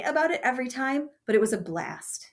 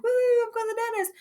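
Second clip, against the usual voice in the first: put-on high, fast, gleeful whoop of a happy kid; excited kid cheer; 13 semitones higher, much faster than usual. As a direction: cheer like a kid let out of school early. Friends cheering about leaving school.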